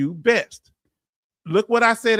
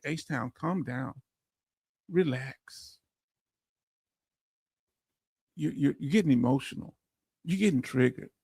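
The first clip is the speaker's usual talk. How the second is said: calm chat voice; 6 semitones lower, 8 dB quieter than usual. Retold or imitated. Streamer voicing chat telling him to calm down, soft and low. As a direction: read it like calming a hothead. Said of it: "They say".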